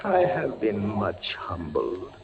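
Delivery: usual speaking voice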